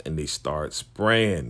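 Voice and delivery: drawn out, weighty telling; slow dramatic emphasis. Retold or imitated. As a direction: let spraying land slowly. Dramatic recap of shooting.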